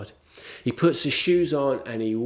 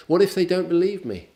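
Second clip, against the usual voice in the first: high, loud and quick, pleading; hammed-up reluctant Moses; whiny Moses; 7 semitones higher, 5 dB louder, faster than usual. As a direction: whine it like a cornered excuse-maker. Moses' third excuse, acted.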